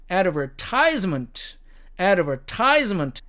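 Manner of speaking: ADVERTISEMENT stressed on TISE, as "ad-ver-TIZE-ment"; American stress. Shown as a demonstration. Teacher showing American accent placement, ADVERTISEMENT stressed on TISE. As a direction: hit the third syllable of ADVERTISEMENT. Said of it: "미국식 액센트로 보면".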